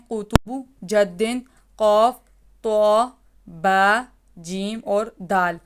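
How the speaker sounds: each letter said slowly and apart, a teacher's drill; slow classroom recital of the qalqala letters. Shown as a demonstration. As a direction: lay out every letter slowly, one by one. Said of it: "ایک دفعہ پھر repeat کر لیتے ہیں".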